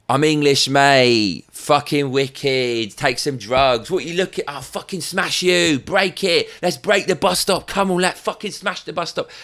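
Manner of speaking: mock yobbish lad, pitch climbing as he goes; rowdy English chav. Mocking British people going crazy, rowdy chav voice. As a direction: play a thuggish chav spoiling to smash things. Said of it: "That's the kind of British mentality".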